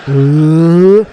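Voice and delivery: very loud drawn-out mock retch. Drunk Mitchell cutting off his own denial, loud mock retch. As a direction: belt out a fake pre-vomit heave. Acting drunk friend about to puke.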